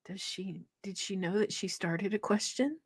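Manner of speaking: put-on whispering kids. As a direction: mutter it under your breath. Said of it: "we sit there and we go".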